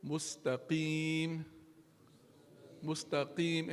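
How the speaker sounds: "mustaqim" slow and exact, spread i; slowly spelled-out "mustaqim"; careful mustaqim. Shown as a demonstration. Teacher showing how to check "mustaqim" in the mirror. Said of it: "pronounce these letters".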